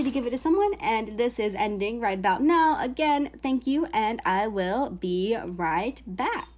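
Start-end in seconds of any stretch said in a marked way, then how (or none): none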